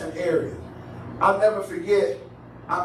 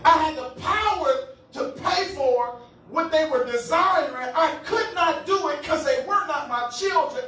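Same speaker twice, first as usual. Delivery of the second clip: high, forceful sermon delivery; impassioned preaching; 9 semitones higher than usual. Real, not acted. Preacher telling congregation why he could not pay, impassioned preaching. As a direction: drive each phrase out to the congregation.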